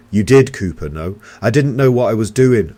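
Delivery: loud, high frantic yell. A narrator's reading. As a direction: shout it in panic. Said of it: "I yelled".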